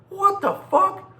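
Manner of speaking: high, incredulous what the fuck. Real, not acted. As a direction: throw up your hands in disbelief. Disbelief at the kid's stupidity.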